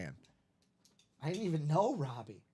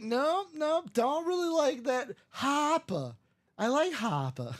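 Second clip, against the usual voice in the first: higher Boston voice, Harper said "Hahpah"; mock Boston husband, higher; Boston accent; 9 semitones higher than usual. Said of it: "He's like".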